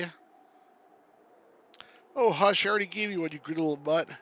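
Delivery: lightly voiced, mildly put-out Canadian character; grumbling Canuck hero. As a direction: recount it with mild mock indignation as a Canuck. Canuck game hero grumbling at dog.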